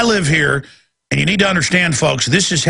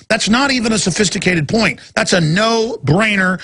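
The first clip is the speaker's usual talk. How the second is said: ordinary talk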